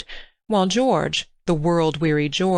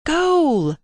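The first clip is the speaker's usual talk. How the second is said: much higher, louder cry of goal; higher shouted goal; 11 semitones higher, 4 dB louder than usual. A narrator's reading. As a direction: let the second goal soar even higher. Boys playing football in pool.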